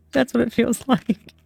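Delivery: chuckling through the words; amused, half laughing. Real, not acted. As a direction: laugh as you say it.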